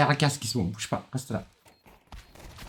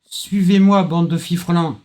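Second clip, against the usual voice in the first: loud, high mock commander; rally the troops grandly; rallying cry; 8 semitones higher, 6 dB louder, slower than usual.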